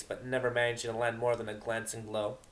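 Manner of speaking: usual speaking voice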